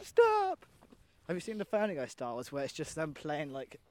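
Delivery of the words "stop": very high, loud mock shriek; imitated pleading cry. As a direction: cry out as if tortured by noise. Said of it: "makes people go".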